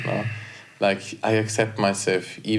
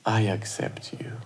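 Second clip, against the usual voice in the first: soft whisper, words for the heart; whispered affirmation; 5 dB quieter than usual. Shown as a demonstration. Host whispering affirmations for listeners to repeat, soft whisper. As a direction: whisper it gently to your own heart. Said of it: "repeat or just listen and receive".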